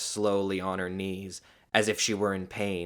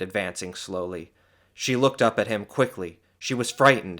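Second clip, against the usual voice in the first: ordinary delivery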